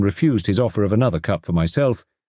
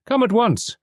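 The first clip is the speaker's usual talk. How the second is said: high, pressing call for help; urgent cry. A narrator's reading. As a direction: summon him in alarm. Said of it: "I cried".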